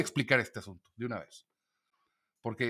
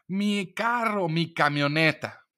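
high, slow, delighted squeal of ownership; mock-gushing wife admiring her new truck; thrilled wife; 6 semitones higher, 3 dB louder, much slower than usual. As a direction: gush over the new truck.

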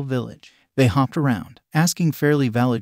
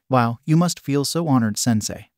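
ordinary delivery

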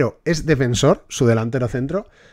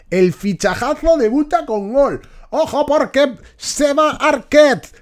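shouted, much higher and louder goal call; goal commentary; 10 semitones higher, 9 dB louder, slower than usual. Pretend. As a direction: scream the goal, then let it fade.

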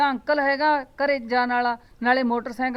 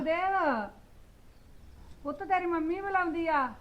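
put-on high call across the yard to the boy; 8 semitones higher, 4 dB quieter than usual. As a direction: pitch it up and call to him from a distance. Calling the boy from afar.

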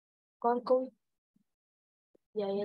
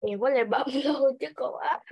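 laughing protest; 4 semitones higher, 5 dB louder than usual. Real, not acted. Student laughs, protests to teacher.

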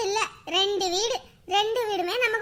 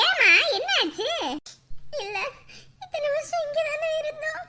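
tearful; 6 semitones higher than usual. Acted. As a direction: speak through held-back tears. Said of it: "ஏன் கண்மணி இப்படி அழுதுட்டு இருக்க".

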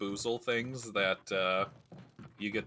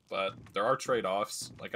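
normal talking voice

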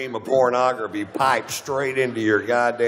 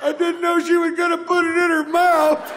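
put-on shocked kid yelling. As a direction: shriek it like a stunned kid at his first dirty movie. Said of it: "and he went".

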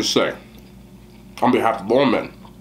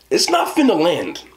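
normal talking voice

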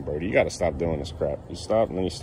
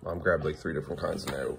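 normal talking voice

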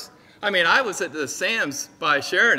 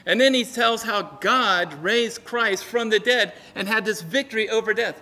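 normal talking voice

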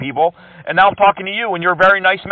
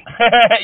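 short, higher amused laugh; 5 semitones higher than usual. Real, not acted. Scam baiter laughing at an angry scammer, amused. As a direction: laugh off the insult.

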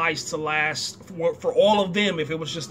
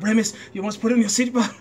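raised-pitch, hammed-up parental warning; playful mock scolding; mock scolding father; 4 semitones higher, 3 dB louder than usual. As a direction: imitate an exasperated dad. Acts father scolding kid in car.

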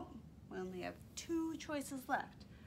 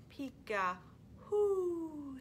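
owl hoot; 6 semitones higher, 3 dB louder, much slower than usual. Acted. Owl sound in story.

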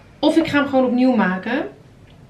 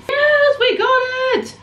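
much higher, thrilled yell of success; triumphant cheer; 14 semitones higher, 3 dB louder, much slower than usual. Real, not acted. To viewers after rescuing the yolk, a high triumphant cheer. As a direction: celebrate the win out loud.

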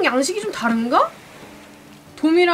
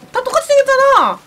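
exasperated yell; 10 semitones higher, 6 dB louder than usual. Real, not acted. Exasperated outburst.

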